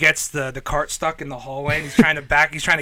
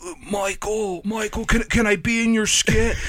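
Chris Farley; 5 semitones higher than usual. Retold or imitated. Comedian asking Michael to be in his sketch, high and pleading. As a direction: imitate a timid, high-voiced plea. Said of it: "Chris walks up to him and is like".

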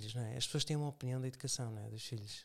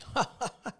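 demonstrative higher-pitched ha-ha-ha; mock laugh; 7 semitones higher than usual. Shown as a demonstration. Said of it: "Tu não te rires". (gargalhada a fingir)

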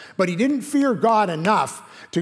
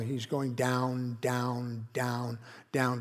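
slow, quieter, each down drawn out; slow emphatic down; 5 semitones lower, 9 dB quieter, much slower than usual. Shown as a demonstration. Preacher traces Jonah's descent, slow sinking repeated down. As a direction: let each down sink slower and softer.

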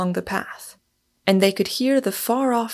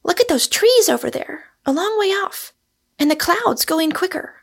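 put-on high-pitched excited young woman character; high, excited exclaiming; excited Rosamond; 10 semitones higher than usual. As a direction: point out the coming storm in a high, eager voice. Voices Rosamond excited by the gale.

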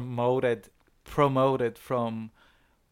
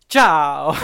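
mock Italian goodbye; 9 dB louder than usual. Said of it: "your man is like".

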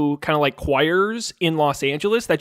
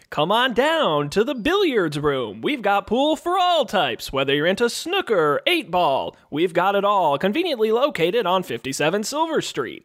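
mild radio ad voice; 3 semitones higher than usual. Retold or imitated. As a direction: sell the pizza like a sleepy local ad read, pitch higher. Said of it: "It was always the same".

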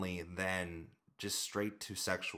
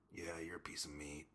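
creepy whispered come-on; 3 semitones lower, 11 dB quieter than usual. Retold or imitated. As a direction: breathe it like a leering creep. Creepy guy staring in gym.

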